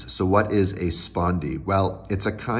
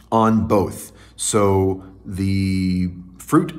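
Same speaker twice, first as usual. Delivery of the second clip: ordinary delivery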